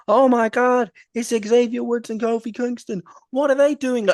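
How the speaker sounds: high, loud, shocked wrestling commentator; Adam Cole commentary. Retold or imitated. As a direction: imitate a commentator crying out in disbelief. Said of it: "you hear Adam Cole go".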